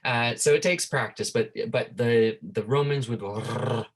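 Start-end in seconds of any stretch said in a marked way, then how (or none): 3.2-3.8 "R" as a long tongue trill; rolled R. Shown as a demonstration. Teacher shows what the Romans would do, "R" as a rolled trill. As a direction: trill "R" hard on the tongue tip. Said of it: "they would roll their R's like that".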